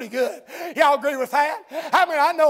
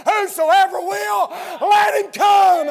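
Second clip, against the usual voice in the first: slow, loud, drawn-out call to come; shouted invitation; 5 semitones higher, 5 dB louder, much slower than usual. Acted. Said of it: "God gives the invitation".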